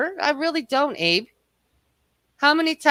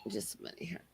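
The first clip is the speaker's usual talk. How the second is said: whispered aside, far softer; 10 semitones lower, 23 dB quieter, faster than usual. Real, not acted. Whispered aside to her co-host while muting. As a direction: whisper it off-mic.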